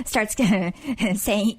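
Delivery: ordinary talk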